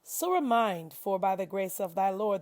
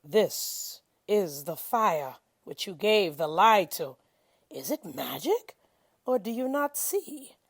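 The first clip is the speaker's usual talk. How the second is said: ordinary talk, nothing marked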